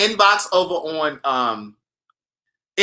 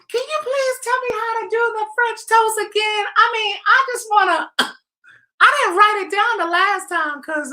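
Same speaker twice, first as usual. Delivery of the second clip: high falsetto whiny fan voice; squeaky mock-begging voice; mock needy viewer; 14 semitones higher than usual. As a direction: mimic the pestering viewer, pitched way up. Mocking viewer emails again.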